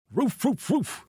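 high-pitched imitation barks; a storyteller's high woofing dog; dog barking. A narrator's reading. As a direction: bark out the words like an excited dog. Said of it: "He started barking like he was stark raving mad".

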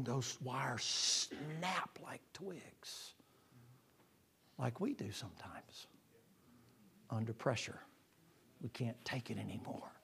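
slow whisper, fading to nothing; soft whispered snap; hushed whisper. Real, not acted. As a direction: let it drop to a hush. Preacher drops to a hush.

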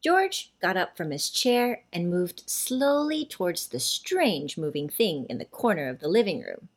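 ordinary delivery, nothing marked

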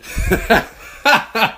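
short high burst of laughter; laughing at his own punchline. Real, not acted. Laughing at his own joke to listeners, a high burst.

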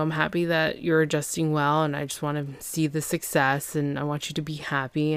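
ordinary delivery

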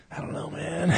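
very soft feigned innocence; sheepish whisper. Retold or imitated. Answering everyone asking about the cats, guilty whisper.

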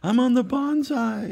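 put-on high, childish sing-song brag; little brother bragging. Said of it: "He just made this up".

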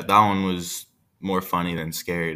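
plain reading voice

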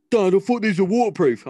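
mock a child's loud protest to dad; complaining daughter. Daughter complains to dad about boots.

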